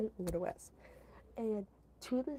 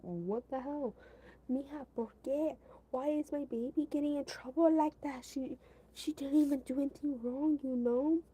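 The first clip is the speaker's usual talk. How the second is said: livid grandma; 6 semitones higher than usual. Retold or imitated. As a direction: defend the grandchild, indignant and raised. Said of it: "my grandma is livid too".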